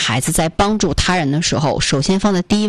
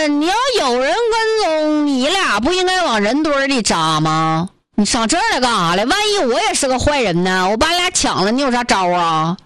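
put-on higher, blunt Northeast accent questioning; 5 semitones higher than usual. Northeast brother questions the woman and student, in Northeast accent. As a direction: challenge them in Northeast accent. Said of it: "操着一口这个东北口音的大哥".

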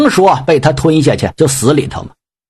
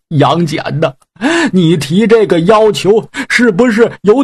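higher, tearful, pleading voice; pleading pig demon, tearful; 4 semitones higher than usual. Acted. Pig demon pleads with Yang Jian to spare his sworn brother, tearful. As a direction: beg him not to make you kill your brother.

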